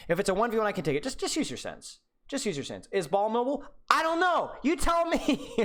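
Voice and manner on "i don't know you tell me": mock clueless. Mocking a viewer's question.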